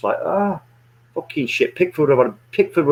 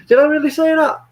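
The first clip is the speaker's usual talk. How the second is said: disbelief; 4 dB louder than usual. Retold or imitated. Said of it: "I was like".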